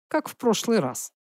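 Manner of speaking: understated teasing, softly spoken; innocent provocation. Acted. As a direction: toss it out softly as bait. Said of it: "открыто провоцировал". (негромко, с невинной подначкой)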